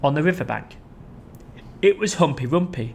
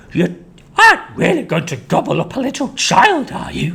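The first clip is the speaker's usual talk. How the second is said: much higher, louder alarm; frightened monkey; 7 semitones higher, 5 dB louder than usual. Acted. Mugglewump shaking at the crocodile. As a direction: plead up high.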